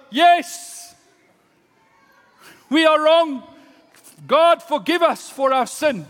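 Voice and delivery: high, loud crowd confession; loud repentant cry. Acted. Ninevites answer Jonah, crying out in repentance. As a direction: cry out for forgiveness at full voice.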